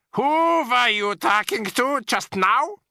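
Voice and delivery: high, slow, sharp witch voice; witch snapping. A narrator's reading. Grand High Witch demanding who calls, sharp witch voice. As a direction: snap it out like a haughty witch. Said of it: "snapped the Grand High Witch".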